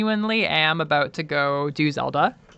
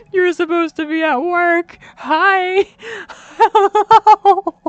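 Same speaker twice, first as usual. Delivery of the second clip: higher, louder, beaming hellos; excited greeting; 13 semitones higher, 8 dB louder, slower than usual. Real, not acted. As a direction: call out happily to your partner. Greeting partner who came home.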